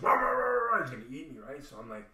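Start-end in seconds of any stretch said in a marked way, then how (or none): 0.0-0.8 loud, high, rapid-fire barks; imitated dog barking. Chained dog going for him, loud imitated barking. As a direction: bark like a lunging guard dog. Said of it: "and the dog's".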